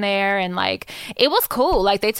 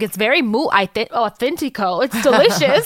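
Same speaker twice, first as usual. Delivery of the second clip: lightly voiced food critic, high and louder; a hint of a fake foodie accent, higher; playful fake Spanish; 5 semitones higher, 4 dB louder than usual. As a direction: ham up a mock connoisseur. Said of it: "I was hella laughing".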